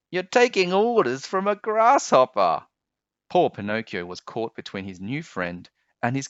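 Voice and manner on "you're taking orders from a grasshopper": put-on high, louder scoffing boy; mocking taunt. A narrator's reading. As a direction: sneer at the puppet for obeying. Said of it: "Lampwick laughed".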